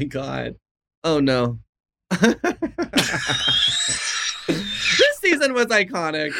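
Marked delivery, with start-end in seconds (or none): 2.1-4.4 long, helpless cackling laugh; real laughter. Real, not acted. Guest rewatching their old acting challenge, long cackle. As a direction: crack up at the sight.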